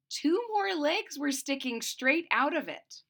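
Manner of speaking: breathless gasp at the sight. A narrator's reading. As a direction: gasp out the words in shock. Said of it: "he gasped".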